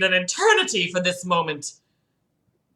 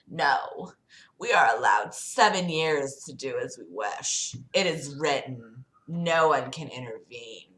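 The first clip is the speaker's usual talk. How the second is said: devil voice; 5 semitones lower, 6 dB quieter than usual. Acted. Satan replies in the story.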